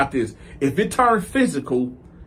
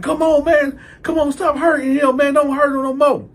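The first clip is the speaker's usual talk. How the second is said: imitating a high, whiny bystander begging; desperate pleading; 8 semitones higher, 4 dB louder than usual. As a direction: beg in a mock-distressed high voice. Other guy pleading for his friend.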